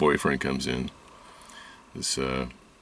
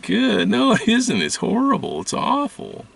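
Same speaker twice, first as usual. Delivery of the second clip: very high, louder despairing wail; hammed-up anguished protest, pitched way up; distraught outburst; 10 semitones higher, 5 dB louder than usual. Retorting to the healer about crying, high and anguished. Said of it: "It's like".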